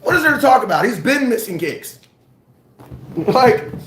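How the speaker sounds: ordinary delivery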